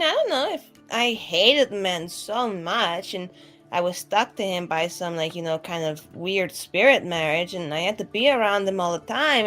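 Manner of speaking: normal talking voice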